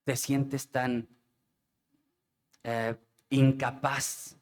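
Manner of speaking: muy lento, casi sin fuerza; impotencia triste. Real, not acted. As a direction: busca la palabra con pesar. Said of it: "es triste". (lento y abatido)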